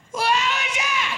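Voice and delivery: high, slow, piercing shout of a startled patient; startled shriek. Retold or imitated. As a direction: shriek it like a needle just went in. Acupuncture patient yelping in pain.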